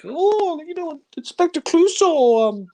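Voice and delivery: high-pitched parody of a Scottish woman; exaggerated Scottish lady, high and cooing; mock Scottish wife. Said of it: "She's putting on a Scottish accent".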